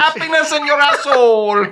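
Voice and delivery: high, comic H-dropping French; mock French accent. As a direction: replay the line as a French caricature. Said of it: "You gotta love French".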